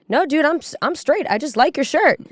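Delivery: imitated guy, pitched high; lightly voiced flustered denial; mock defensive protest. Man protests he is straight.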